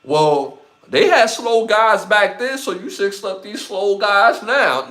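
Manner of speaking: high, loud whiny-excuse pitch; mock athlete making excuses. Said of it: "you don't see athletes be like".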